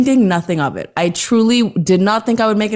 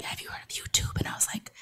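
barely audible whispered retelling; ASMR whisper; 17 dB quieter than usual. Pretend. Guest retelling a friend's YouTube question, whispered. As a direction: whisper it like a secret. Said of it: "I'm gonna do A S M R".

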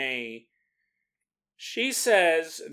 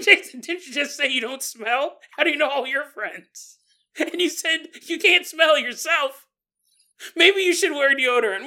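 laughing through a teasing challenge, higher and louder; laughing tease; 7 semitones higher, 4 dB louder than usual. Pretend. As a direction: needle the host while cracking up. Imagined listener teasing host.

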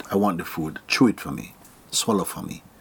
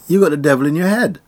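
higher, louder scolding retort; mock scolding; 8 semitones higher, 8 dB louder than usual. Jamaican elders rebuking someone, loud scolding. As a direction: call it out like a scolding neighbour.

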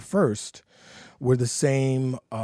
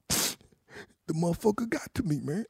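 tearful friend; 7 semitones higher, 4 dB quieter than usual. Retold or imitated. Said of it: "and he said".

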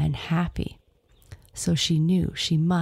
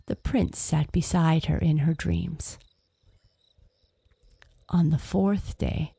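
ordinary delivery

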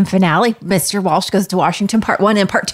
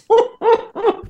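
high laugh. Real, not acted. Laughs at "a lot.

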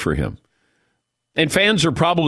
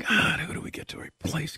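mock whisper; 3 semitones higher, 10 dB quieter than usual. Host mimics Frank Reich plotting, in a whisper. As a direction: whisper like a coach plotting in secret. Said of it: "Frank Reich is going".

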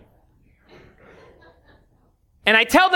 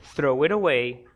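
tired manager; 9 semitones lower, 5 dB quieter than usual. Retold or imitated. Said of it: "she's like".

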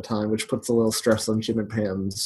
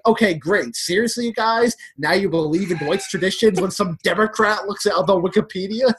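higher-pitched imitation of exasperation; mock indignation; 7 semitones higher than usual. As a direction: protest like you're offended they doubted you. Acting out Dwight's indignant complaint.

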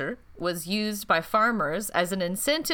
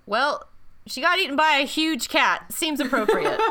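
mock eulogy; 7 semitones higher, 4 dB louder than usual.

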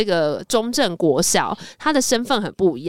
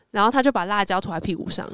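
usual speaking voice

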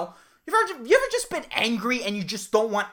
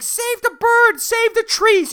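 high, loud, slow preachy mock voice; mocking do-gooders; 12 semitones higher, 5 dB louder, slower than usual. Host mocking everyone who says save the birds, high and shrill-loud. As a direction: parody the save-the-planet crowd. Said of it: "And everyone's like".

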